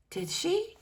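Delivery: high, hopeful squeak kept soft; a small boy's hopeful squeak; eager squeaky question. A narrator's reading. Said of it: "His voice was squeaky with hope".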